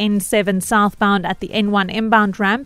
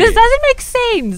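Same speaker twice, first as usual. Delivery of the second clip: exasperated; 11 semitones higher, slower than usual. Real, not acted. Flustered host protests the task.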